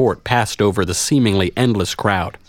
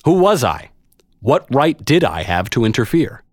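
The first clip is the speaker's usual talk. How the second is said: usual speaking voice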